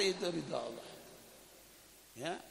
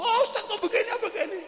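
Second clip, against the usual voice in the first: much higher, laughing mock scorn; mocking critic; 4 dB louder than usual. Gossiping critic taunting the ustaz, high laughing mockery. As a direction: tease the preacher in a high sneering voice.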